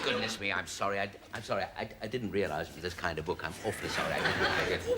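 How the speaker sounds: low, soft, hurried apology; mortified, voice dropped low; embarrassed apology. Acted. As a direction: stammer an abashed apology. Embarrassed by the racy book.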